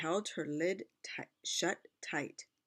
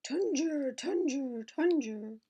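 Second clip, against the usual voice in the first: rolling chant; 9 semitones higher, slower than usual. Acted. Pot rolling sound chant.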